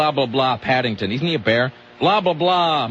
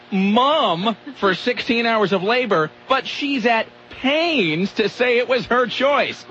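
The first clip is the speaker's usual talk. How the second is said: slow, higher, every word leaned on; mocking read; 7 semitones higher, slower than usual. Shown as a demonstration. Host mocking a tabloid article, reading it slowly and pointedly. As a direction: read it out to ridicule the writing. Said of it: "listen to this goddamn writing".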